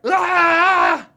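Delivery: loud, high wordless scream; child's frustrated scream. Pretend. Imitating a stressed kid to parents, a loud high scream. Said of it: "And that".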